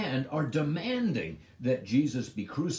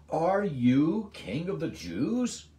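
slow, loud, sneering question, pitched up; sarcastic question; 7 semitones higher, 6 dB louder, slower than usual. Acted. Pilate questioning Jesus, slow and loudly sarcastic. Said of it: "The first one was of sarcasm".

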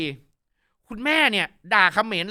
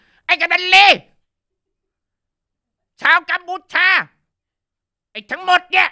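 imitating a furious challenge, higher and louder; harsh defiant challenge; 10 semitones higher, 11 dB louder than usual. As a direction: hurl the challenge like a fierce rally cry. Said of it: "น้ำเสียงแข็งกร้าว". (เลียนเสียงท้าทายแข็งกร้าว เสียงสูงและดังขึ้น)

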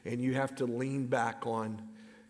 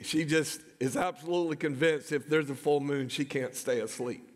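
normal talking voice

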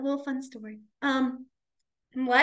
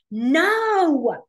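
loud, higher, indignant no; dramatic protest; 6 semitones higher, 7 dB louder, much slower than usual. Retold or imitated. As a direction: insist hard, friend, boy. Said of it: "they'll just get so dramatic on it".

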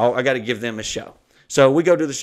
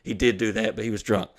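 ordinary talk

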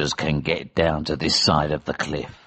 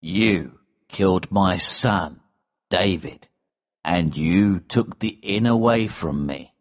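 calm and cold; 4 semitones lower than usual. A narrator's reading. Elsevier confronts his son's killer, calm and cold. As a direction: take your time, coldly deliberate. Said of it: "He spoke calmly and coldly".

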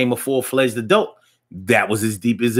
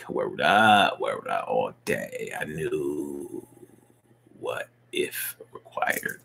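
deep mutter; 10 semitones lower, 8 dB quieter, much slower than usual. Shown as a demonstration. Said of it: "deep muttered voice".

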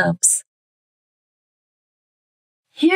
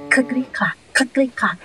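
ordinary delivery

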